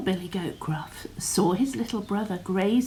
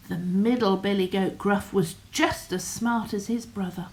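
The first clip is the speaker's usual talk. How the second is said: ordinary delivery, nothing marked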